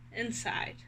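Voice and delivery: a soft, drawn-out single word; slow quiet answer. A narrator's reading. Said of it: "After five steps and five hours".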